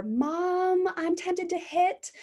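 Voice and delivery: put-on high, slow child asking mom; a young daughter telling mom she's tempted to hit; little girl voice. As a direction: speak it up high like a small child. Child voiced in redo example.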